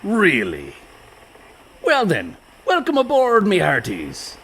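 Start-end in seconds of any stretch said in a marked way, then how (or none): 1.8-4.4 hearty welcome. Acted. Captain welcoming the brothers to his crew, hearty and high. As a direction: boom a jolly welcome aboard.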